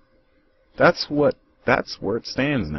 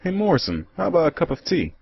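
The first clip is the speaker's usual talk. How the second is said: put-on much higher old codger voice; breezy old man dropping in for tea; old visitor calling to his friend; cheery old man; 11 semitones higher than usual.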